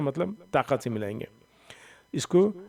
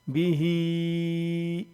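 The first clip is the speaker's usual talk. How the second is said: slow, drawn-out chanted recitation; Quran recitation; 4 semitones higher, much slower than usual. Shown as a demonstration. Teacher reciting the finished word for students, slow chant. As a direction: intone the word like Quran tilawat.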